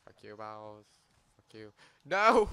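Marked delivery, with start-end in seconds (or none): 2.0-2.5 sudden loud high yell. Real, not acted. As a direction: shout it out in shock. Losing in game, yelling.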